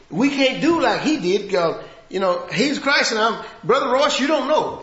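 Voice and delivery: rising to a pulpit pitch; fervent sermon; full-force preaching. Acted.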